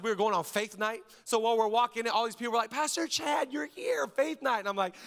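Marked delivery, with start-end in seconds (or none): 2.7-4.6 higher, delighted greeting; excited fans. Retold or imitated. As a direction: spot your pastor at the ballpark.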